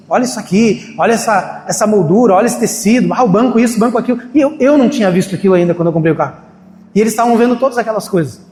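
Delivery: ordinary delivery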